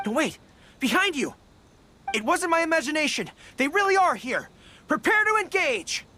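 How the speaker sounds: rising commanding shout; battle call. Real, not acted. Gorou ordering his side into a fight with Fatui.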